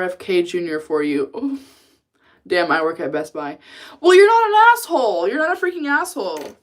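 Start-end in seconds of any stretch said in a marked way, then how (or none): 4.0-6.6 indignant protest. Real, not acted. Protests to a chat message.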